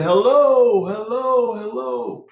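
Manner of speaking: calling hello in a high voice; high, searching hello into empty space. Retold or imitated.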